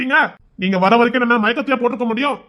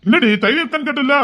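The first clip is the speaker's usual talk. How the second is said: ordinary talk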